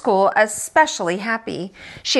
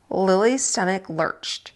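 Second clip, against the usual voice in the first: plain reading voice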